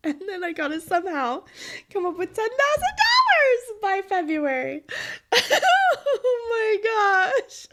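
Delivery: high, loud laughing disbelief; real nervous laughter; laughing in panic. Real, not acted. Panicked laughing about debt.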